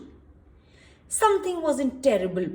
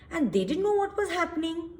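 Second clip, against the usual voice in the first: plain reading voice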